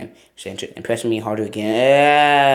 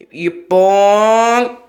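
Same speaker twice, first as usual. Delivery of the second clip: dramatic shout; 7 semitones higher, 8 dB louder than usual. Pretend. Dramatic howl-like line.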